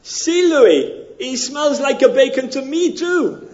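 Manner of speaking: high, loud hammed-up Mexican buddy; comic Mexican accent. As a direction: play the eager second Mexican. Joke voicing the other Mexican.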